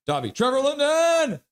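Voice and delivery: rowdy fan cheer; acted hype shout. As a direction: chant bursting into a high, held yell. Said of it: "Sensei Demo is like".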